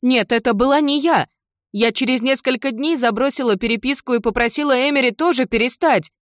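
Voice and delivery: high, loud, desperate protest; крик девочки. Acted. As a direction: cry out in frantic denial. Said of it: "закричала Кендалл". (отчаянный крик)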